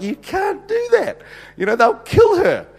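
high, slow horrified protest; aghast. Retold or imitated. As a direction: gasp it out as if it's a disaster. Office staff aghast at request.

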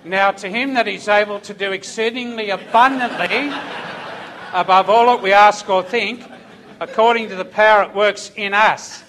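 loud, forceful scripture declamation; emphatic, loud pulpit delivery; preaching. Acted. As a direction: proclaim the verse to a congregation at full force. Quotes scripture at full force.

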